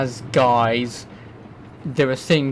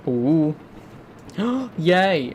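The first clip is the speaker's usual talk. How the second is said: gasp, then a higher mock-pleased coo; imitated gift delight; 5 semitones higher than usual. Someone handed a present, imitated gasping delight.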